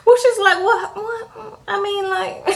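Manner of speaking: high, squeaky with laughter while retelling; words breaking into high giggles; real laughing. Real, not acted. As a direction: crack up halfway through the quote. Cracks up while retelling her reply.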